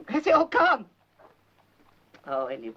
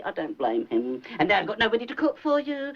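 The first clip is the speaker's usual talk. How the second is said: ordinary talk, nothing marked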